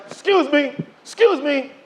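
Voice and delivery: mock eager interruption; interrupting politely. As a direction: reenact butting in with a question. Interrupting service with a question.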